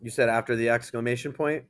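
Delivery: ordinary talk, nothing marked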